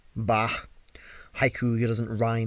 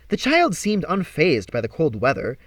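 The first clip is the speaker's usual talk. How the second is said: ordinary delivery, nothing marked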